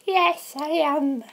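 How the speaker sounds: put-on slow, very high girly falsetto; Georgina. Contestant confirming nerves to the host, girly voice. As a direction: answer in a put-on girly squeak. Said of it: "why did you do that girly voice".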